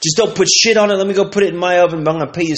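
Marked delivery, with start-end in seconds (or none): none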